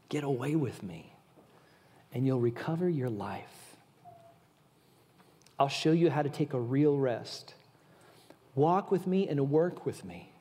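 hushed comforting read; gentle scripture reading. Acted. Reads gentle scripture paraphrase.

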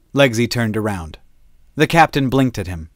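plain reading voice

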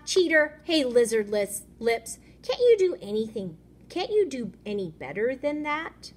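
put-on high, loud jeering kid; bully taunts. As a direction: hurl each taunt like a bully.

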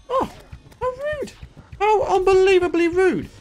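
high, prissy mock outrage; mock offended. Real, not acted. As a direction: act scandalised by the rudeness.

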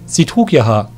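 usual speaking voice

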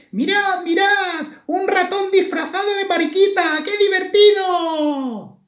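piercingly high, loud squeal; shouting ladybirds. A narrator's reading. Ladybirds shout at a mouse disguised as one, gleeful squealing. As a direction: cry out in a tiny squeaky voice, dragging the end. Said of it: "las que gritaron".

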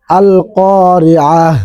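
"আল কারিআহ" ending in a stopped h, slow; pausal form. Shown as a demonstration. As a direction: recite "আল কারিআহ" stopping on the final h. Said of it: "সাকিন দিয়ে পড়তে হবে". ("আল কারিআহ" থেমে হ দিয়ে শেষ)

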